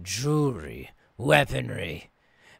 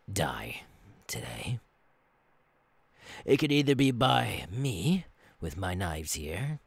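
usual speaking voice

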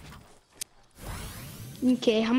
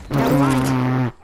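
deep voice; 10 semitones lower, 7 dB louder, slower than usual. Real, not acted. Sudden deep loud voice.

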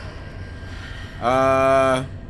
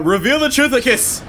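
hammed-up spell cry, rising; mock-grand wizard flourish; over-the-top incantation; 7 semitones higher than usual. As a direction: cast the invented spell with excess drama. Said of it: "Oh, I'm being extra".

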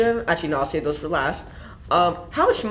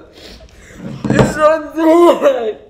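very high, squealing laughing voice; hammed-up high-pitched laughter; 10 semitones higher, 6 dB louder, slower than usual. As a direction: wail it high and loud through laughter. Mock audio from the crime.

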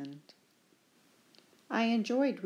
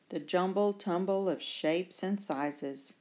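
usual speaking voice

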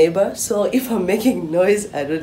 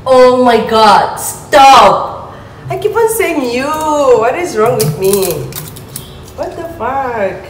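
exasperated, louder and slower; frustrated; 4 semitones higher, 4 dB louder, slower than usual. Real, not acted. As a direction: scold yourself in exasperation. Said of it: "I'm getting frustrated".

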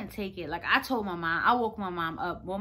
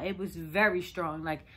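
normal talking voice

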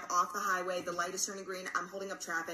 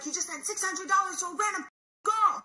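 high, loud, frantic outburst; panicked yelling; 12 semitones higher, 7 dB louder than usual. Retold or imitated. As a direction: reenact a panicked yell. Her frantic reply, retold.

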